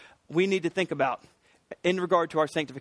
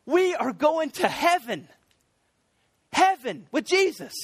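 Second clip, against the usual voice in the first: high, slow preacher's exclamation; fervent proclamation; 9 semitones higher than usual. Acted. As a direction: proclaim it with soaring, deliberate conviction. Repeats the point preaching fervently.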